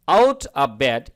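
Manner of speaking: "out of bed" slow, each word cut apart; choppy word by word. Shown as a demonstration. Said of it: "এভাবে কাটা কাটা করে বলবেন". ("out of bed" কাটা কাটা করে)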